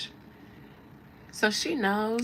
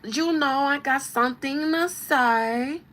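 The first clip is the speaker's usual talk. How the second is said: loud exaggerated anime-style accent impression; 6 semitones higher, 6 dB louder than usual. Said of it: "she's real anime like that".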